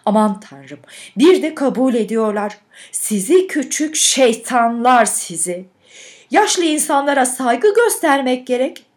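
loud, exasperated telling-off; indignant scolding. A narrator's reading. Stunned patroness to the boys who confessed, indignant scolding.

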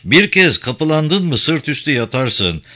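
usual speaking voice

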